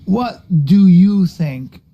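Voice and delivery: drawn-out exasperated question; slow each word like a fed-up parent; host scolding his guest slowly. Real, not acted.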